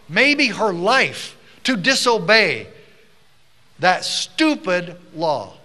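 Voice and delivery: loud, slow, hammered-out declaration; impassioned speech. Real, not acted. Speaker rallying the audience against stupid laws, in impassioned speech. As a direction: drive each phrase home, slowly and loudly.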